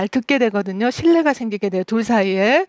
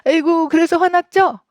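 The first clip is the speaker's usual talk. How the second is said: higher and louder sympathetic cooing; coddling mother; 6 semitones higher, 3 dB louder than usual. Retold or imitated. Indulgent mother cooing at her angry child. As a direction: coo over the child to soothe it. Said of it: "감정은 그냥 받아주지만".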